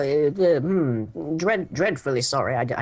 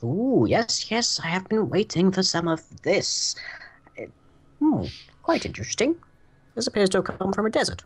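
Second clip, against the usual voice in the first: high, eager and fascinated; delighted alchemist; 7 semitones higher than usual. Acted. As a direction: gush over a rare find. Alchemist examining the scales.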